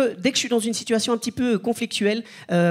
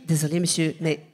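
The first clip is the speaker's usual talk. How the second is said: lightly voiced teacher, lower-pitched; teacher's polite refusal; 6 semitones lower, 3 dB quieter than usual. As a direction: turn him down in a low teacher's voice. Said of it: "la prof m'a dit".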